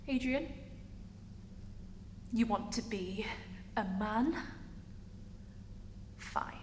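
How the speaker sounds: soft, halting, down to a whisper; resigned acceptance. Acted. Character accepting partner Adrian's wish, hushed resignation. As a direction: concede quietly with long pauses.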